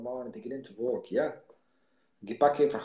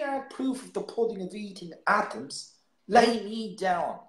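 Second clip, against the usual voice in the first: mock dramatic recital; 6 semitones higher than usual. Quoting a poem dramatically.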